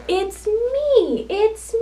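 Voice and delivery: high, sing-song reveal; storybook cow. Acted. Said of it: "says the cow".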